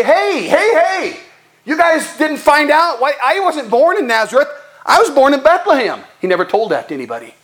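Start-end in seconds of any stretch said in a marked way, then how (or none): 0.0-6.0 much higher calling hey, hey, setting the record straight; Jesus calling out. Pretend. Jesus calls out to the doubting crowd, high and urgent. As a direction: call out to the crowd to set them straight.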